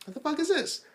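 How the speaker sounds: very high-pitched mock disbelief; baffled reaction. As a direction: squeak it out as a bewildered question. Retelling own baffled reaction to scene.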